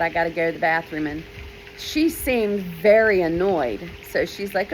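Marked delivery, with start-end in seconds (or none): 0.0-1.1 fast, urgent excuse; pleading excuse. Retold or imitated. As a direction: rush the plea out. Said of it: "My belly hurts really bad".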